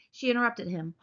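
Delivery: plain reading voice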